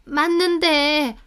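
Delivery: high, slow, sheepish admission; cowering. A narrator's reading. Mother-in-law answers her angry husband, cowering. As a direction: admit it reluctantly, dragged out. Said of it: "아버님 앞에서 쩔쩔매더니".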